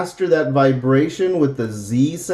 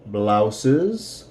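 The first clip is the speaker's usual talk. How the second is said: slow model question; 2 semitones lower, much slower than usual. Shown as a demonstration. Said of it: "how much are these blouses".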